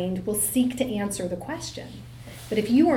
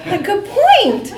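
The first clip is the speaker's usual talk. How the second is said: said through high loud laughter, slowly; laughing; 11 semitones higher, 12 dB louder, slower than usual. Real, not acted. Mother admits her son's point, laughing loudly. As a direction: concede it while still cracking up.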